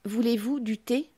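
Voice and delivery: a touch of flustered unease; faltering voice. A narrator's reading. Flustered mother offering tea, mildly faltering voice. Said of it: "d'une voix entrecoupée".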